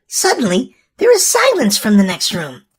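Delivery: ordinary delivery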